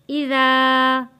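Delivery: इज़ा with the ज़ा drawn out long; slow recitation holding the alif in इज़ा; stretched Quranic reading. Shown as a demonstration. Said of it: "हरूफे मद्दा है".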